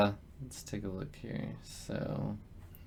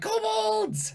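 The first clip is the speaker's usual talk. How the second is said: kobold battle cry. Acted. Kobolds charge with spears raised.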